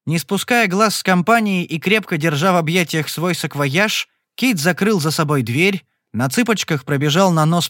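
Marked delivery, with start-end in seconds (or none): none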